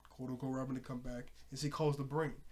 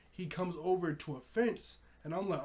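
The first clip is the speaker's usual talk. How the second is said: normal talking voice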